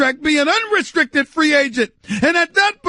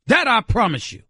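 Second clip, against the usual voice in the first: normal talking voice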